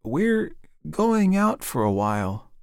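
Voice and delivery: high, slow, unsteady voice; wavering call. A narrator's reading. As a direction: call to the children, hesitant and drawn out. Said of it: "called in a wavering voice".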